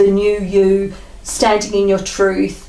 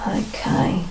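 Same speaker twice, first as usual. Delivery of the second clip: whisper; 5 dB quieter than usual. Real, not acted. Whispers between card draws.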